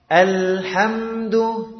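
alhamdu slow with the L held clean, not "ala"; correct reading. Shown as a demonstration. Teacher contrasts the right alhamdu with "ala". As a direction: say alhamdu slowly, L steady, never split as "ala". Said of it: "tidak boleh dibaca ala, tapi".

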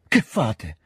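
high-pitched anguished cry; desperate. A narrator's reading. Second princess rushing out of the room, desperate. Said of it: "proferì disperatamente".

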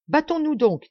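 loud, high call to fight; rallying cry. A narrator's reading. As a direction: rouse them to battle. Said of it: "dirent les autres".

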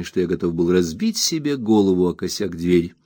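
ordinary delivery

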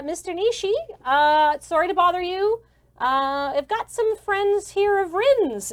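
hesitant apologetic usher. Acted. Kina disturbing the boss at his door, apologetic. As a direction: interrupt politely, stalling on the ums.

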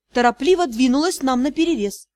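plain reading voice

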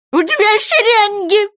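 high, loud goby cry; shouted correction. A narrator's reading. Goby interrupts the sea wolf, shouting. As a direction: shout it over the other speaker, high. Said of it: "прикричал его морской бычок".

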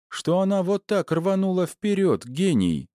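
high-pitched sarcastic jab; derisive, high girl's character voice; mocking. A narrator's reading. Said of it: "насмешливо бросила она".

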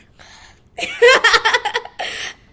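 long high burst of laughter; loud laugh. Real, not acted. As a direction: laugh out loud, nervously amused. Laughing about the pregnancy scare.

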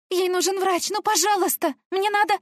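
high, loud desperate begging; tearful pleading. A narrator's reading. Girl begs the stranger through tears, high and loud. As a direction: beg through tears for a doctor. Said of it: "Из-за слёз".

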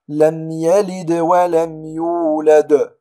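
"لم يلد ولم يولد" loud slow chant, stronger bounce on the last d; قلقلة صغرى ثم كبرى. Shown as a demonstration. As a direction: echo d in "يلد", harder in "يولد". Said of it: "قلقلة كبرى". (تلاوة "لم يلد ولم يولد" بقلقلة قوية في الوقف)

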